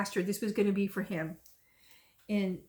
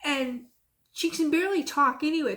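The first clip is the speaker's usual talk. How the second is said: normal talking voice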